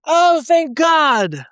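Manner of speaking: high, slow, hammed-up relief; exaggerated relief. Mock relief at reunion.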